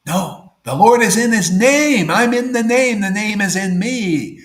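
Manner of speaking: loud, high proclamation; preacher proclaiming at full force; forceful preaching. Real, not acted. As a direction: declare it with full conviction. Preaching his main point.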